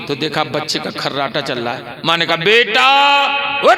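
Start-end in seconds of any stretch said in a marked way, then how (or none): 2.4-3.8 sharp rising wake-up call; पुकारकर जगाना. Retold or imitated. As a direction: call out to rouse a sleeper. Mother calls sleeping son awake. (ज़ोर से पुकारकर जगाती माँ)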